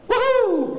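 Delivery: high whooping yelp of relief; mock celebratory whoop. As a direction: let out a quick high woohoo. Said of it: "that I kind of".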